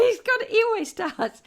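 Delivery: high, loud laughing complaint. Real, not acted. Telling viewers he misunderstood, laughing. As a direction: talk through amused exasperation.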